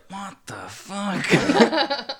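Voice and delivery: high, drawn-out disbelief; mock bewilderment. Fan voices McClane's reaction to the scene, drawn-out mock bewilderment. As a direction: stretch it out, comically dumbfounded. Acts out film character's stunned reaction.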